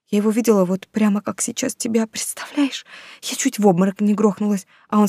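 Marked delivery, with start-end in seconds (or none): none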